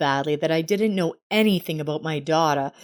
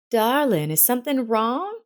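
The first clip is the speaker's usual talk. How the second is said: excited; 7 semitones higher than usual. A narrator's reading. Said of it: "the woman asked excitedly".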